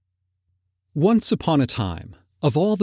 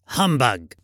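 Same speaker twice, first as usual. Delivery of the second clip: grumpy Scrooge. A narrator's reading. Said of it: "followed it up with".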